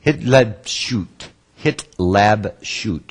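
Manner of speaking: Hitlabshut said slowly, syllable by syllable; slow Hebrew term. Shown as a demonstration. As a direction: break Hitlabshut into hit-lab-shut, slow. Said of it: "You can break it down in three syllables".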